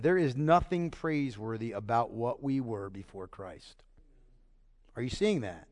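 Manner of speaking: quiet solemn warning; hushed gravity. Real, not acted. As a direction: drop to a grave hush. Hushed serious point.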